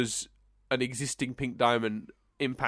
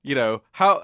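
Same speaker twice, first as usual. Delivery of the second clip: usual speaking voice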